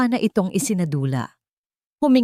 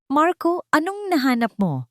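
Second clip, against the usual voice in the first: eager question; 11 semitones higher than usual. Acted. Boss eagerly asks for news.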